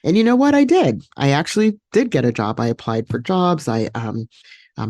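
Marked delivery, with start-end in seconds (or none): 0.0-2.6 spring the good news; triumphant. Real, not acted. Triumphant reveal of getting job.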